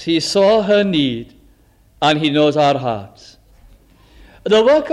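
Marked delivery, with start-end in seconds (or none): none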